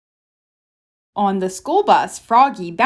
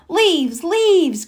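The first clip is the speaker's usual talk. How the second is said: high, eager shouts of leaves; excited cry; 8 semitones higher than usual. A narrator's reading. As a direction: call out the leaves with delight. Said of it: "cried Froggy".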